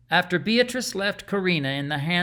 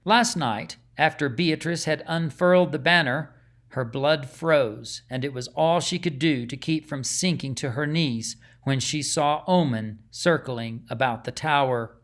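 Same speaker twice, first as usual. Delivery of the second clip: usual speaking voice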